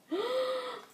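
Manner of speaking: high, quick gasp; short stunned intake of breath; astonished gasp. Real, not acted. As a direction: gasp in disbelief at a gift. Overwhelmed by the gift.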